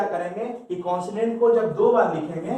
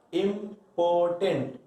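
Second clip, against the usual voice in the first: slow and clear; much slower than usual. Shown as a demonstration. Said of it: "ये more than two syllable है".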